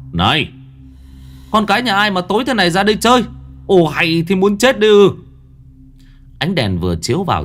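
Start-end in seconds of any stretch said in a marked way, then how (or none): 0.1-5.1 sharp shout, higher and louder, fast; quát lớn. A narrator's reading. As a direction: bark it out at the kids. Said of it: "kèm theo tiếng quát". (quát to, nhanh và gắt)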